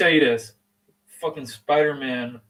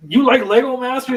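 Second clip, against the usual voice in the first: much higher and louder, eager; excited; 11 semitones higher, 11 dB louder than usual. Real, not acted. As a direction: burst out about the show. Excited about a favourite show.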